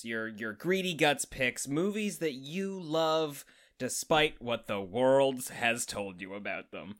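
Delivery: ordinary talk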